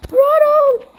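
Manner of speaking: high, loud panicked calls for a friend; frantic cries to a fallen friend; panicked shouting. Acted. Shouting as cabin burns.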